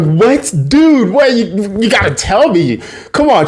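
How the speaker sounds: high, insistent disbelief; high-pitched incredulous demand; mock astonishment. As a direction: press a friend for gossip in put-on shock. Friend reacts, demands to know.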